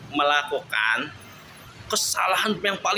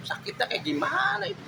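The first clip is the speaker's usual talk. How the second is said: pained rhetorical question; 13 semitones higher, 4 dB quieter than usual. Real, not acted.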